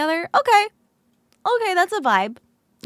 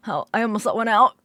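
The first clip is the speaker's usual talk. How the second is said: ordinary delivery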